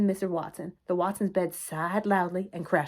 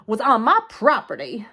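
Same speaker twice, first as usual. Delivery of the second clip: indignant; 3 semitones higher, 8 dB louder than usual. A narrator's reading. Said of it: "Eugenia was breathing very loudly".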